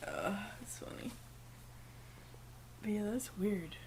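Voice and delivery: softly whispered aside. Real, not acted. A whispered aside to his co-host. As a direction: whisper it under your breath.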